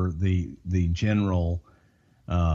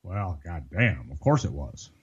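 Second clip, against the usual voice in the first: low, slow, softer grumble of a resigned boss; Vince McMahon; 5 semitones lower, 3 dB quieter than usual. Pretend. Boss answers agent about the flight attendant, slow low grumble. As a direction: drag it out low, like a boss who's seen it all. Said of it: "freestyle what you think Vince's reaction".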